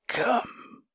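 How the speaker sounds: high croaking ghoul voice. A narrator's reading. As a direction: croak it like a grotesque monster. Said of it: "croaked the ghoul".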